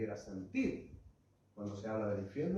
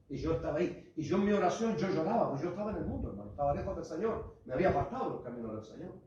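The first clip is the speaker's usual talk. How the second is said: fervent testimony; 5 semitones higher, 4 dB louder than usual. Acted. Testimony of praying in tears.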